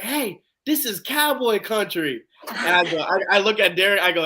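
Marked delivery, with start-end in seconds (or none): 0.0-2.2 high, drawn-out mock holler; retold yell. Bum across the street heckling Titans fans, mock yelling. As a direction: imitate a heckler yelling slowly, without real volume. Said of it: "he was yelling".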